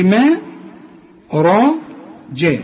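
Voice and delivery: "ما را جا" with every syllable heavy and broad; wrong heavy م. Shown as a demonstration. Teacher imitates the student's wrong heavy "ما". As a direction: show the student's mistake of a heavy "ما". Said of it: "تقول لي".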